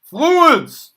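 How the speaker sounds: New York accent yell. Shown as a demonstration. Teacher yelling the topic in New York accent to class. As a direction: bellow "Fluids!" like a brash New Yorker. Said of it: "when I yelled".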